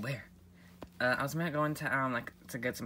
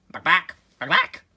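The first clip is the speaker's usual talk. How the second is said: loud sing-song call; 5 semitones higher, 16 dB louder, slower than usual. Acted. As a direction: call out back back, rising. Calling out loudly.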